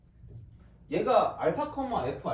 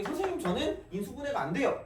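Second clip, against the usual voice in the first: lightly voiced student, higher pitch; 7 semitones higher than usual. Student telling teacher they can't factorise, lightly voiced plea. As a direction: pitch it up a little as a helpless student.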